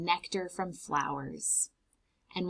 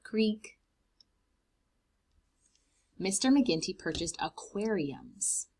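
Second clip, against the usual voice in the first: ordinary delivery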